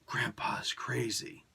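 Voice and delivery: slow, breathy whispered quote; hushed whisper. Retold or imitated. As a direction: whisper it like a dark secret. Quoting the line a third time.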